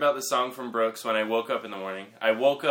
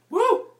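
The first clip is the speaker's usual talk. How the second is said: high, loud "woo" cheer; high whoop; 8 dB louder than usual. Pretend. Guest cheering his introduction, high whoop.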